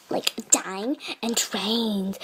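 low unvoiced whisper. Real, not acted. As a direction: breathe it like a secret. Secret whisper among friends.

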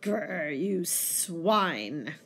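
low, muted growl of loathing; growled curse. Acted. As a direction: snarl it low under the breath. Monk's closing curse in the poem.

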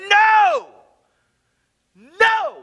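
high, loud, drawn-out cry of denial; freaked-out shout. Retold or imitated. Friend hearing his best friend is dying, freaked-out shout. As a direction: shout it out high in panicked refusal. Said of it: "I start to freak out".

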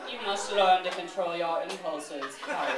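low, measured intoned menace; icy intoning. Acted. Snape to Harry on controlling impulses, icy intoning. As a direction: intone it low, each word a chip of ice. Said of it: "he intoned, each word a chip of ice".

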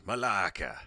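loud sharp shouted taunt; teen shouting at the Americans; yelled insult. Retold or imitated. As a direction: throw the insult as a yell.

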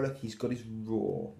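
plain reading voice

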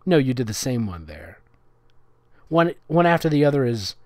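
ordinary delivery

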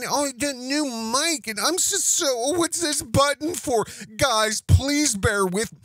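mock clueless newbie. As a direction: squeak out the wide-eyed act. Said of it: "He's been doing this for over ten years".

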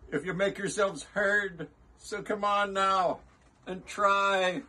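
high, earnest rallying call. A narrator's reading. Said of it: "he managed to call to the mayor".

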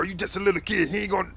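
ordinary talk, nothing marked

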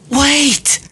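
very high, loud scream. A narrator's reading. Sam stops Argus crushing Lilith, screaming. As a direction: scream to stop them. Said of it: "Sam screamed at the top of his lungs".

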